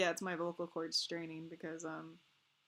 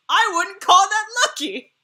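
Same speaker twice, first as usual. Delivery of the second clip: loud, high, disbelieving; loud indignant exclamation; exasperated outburst; 12 semitones higher, 18 dB louder than usual. Real, not acted. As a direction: let the outrage burst out. Heated about allergy.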